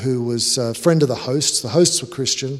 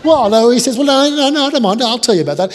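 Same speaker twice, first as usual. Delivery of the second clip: much higher, loud flustered protest; high, loud mock panic; backpedaling guest; 11 semitones higher, 8 dB louder than usual. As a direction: scramble to save face.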